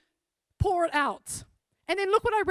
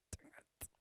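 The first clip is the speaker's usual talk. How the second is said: retold whispered reluctance, under the breath; whispered grumble; 28 dB quieter than usual. Speaker grudgingly answers God's nudge, whispered grumble. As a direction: whisper a reluctant complaint to yourself.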